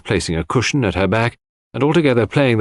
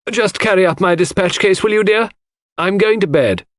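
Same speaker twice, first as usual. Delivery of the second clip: called from the hall, higher and louder; calling out; 10 semitones higher, 3 dB louder than usual. Acted. Mrs Inglethorpe calling Cynthia from the hall, raised voice. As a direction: call up to the girl across the house.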